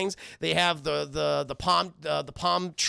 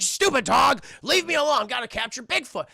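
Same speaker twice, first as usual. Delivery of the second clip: loud, raised cartoon grouch; irritated shout; 6 semitones higher, 5 dB louder than usual. Eustace to dog while hunting, irritated. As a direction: brush the dog off angrily.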